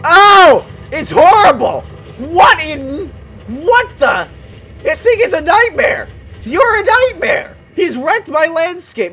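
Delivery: high, loud appalled shouting; horrified outburst. Real, not acted. Gamer yelling at a nightmare of a mob, horrified outburst. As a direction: wail in loud protest at it.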